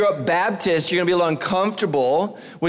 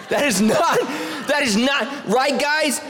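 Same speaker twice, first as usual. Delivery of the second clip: higher, laughing shout; laughing protest; 8 semitones higher, slower than usual. Real, not acted. Pastor appealing to the guys with a high laughing shout.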